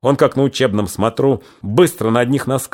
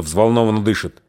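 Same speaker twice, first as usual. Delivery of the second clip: ordinary delivery, nothing marked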